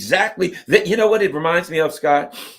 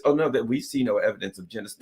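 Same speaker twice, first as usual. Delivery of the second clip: quick, low, softer official brush-off; mock denial; 3 semitones lower, 7 dB quieter, much faster than usual. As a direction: play a hurried official denying everything.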